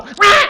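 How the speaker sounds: high, loud mock baby cry; baby wail. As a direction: wail like a baby pushing away the bottle. Baby refuses the bottle.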